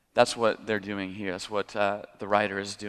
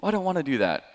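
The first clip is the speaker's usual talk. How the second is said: put-on whiny toddler; 7 semitones higher, much faster than usual. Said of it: "sort of like a toddler".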